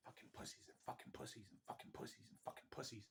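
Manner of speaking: voiceless, rapid whispered chant; imitated ghostly whispering. Imitating whispers heard down the hallway, rapid ghostly whisper. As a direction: whisper it fast like an unseen entity. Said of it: "the whispering starts getting louder and louder".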